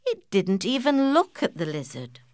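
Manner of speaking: usual speaking voice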